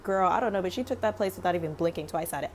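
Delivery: usual speaking voice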